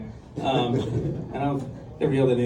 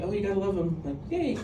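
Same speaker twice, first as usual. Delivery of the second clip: soft, higher mock cheer; mock fondness; 4 semitones higher, 7 dB quieter than usual. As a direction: gush quietly, ending on a tiny high yay. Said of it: "I don't mean like".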